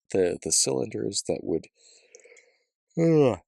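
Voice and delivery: talking through a yawn; words stretched by a yawn. Real, not acted. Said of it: "excuse me for yawning".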